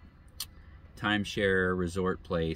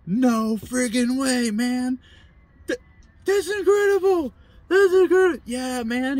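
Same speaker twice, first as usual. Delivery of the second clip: much higher, louder, stretched-out disbelief; high, loud astonished cry of a stranger in a story; stunned amazement; 9 dB louder than usual. Retold or imitated. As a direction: shout it out like you can't believe your ears.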